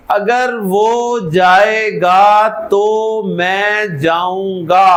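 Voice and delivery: each word spaced out slowly; slow word count. Shown as a demonstration. Teacher counting the words for students, slow. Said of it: "कितने लफ्ज हैं जी?".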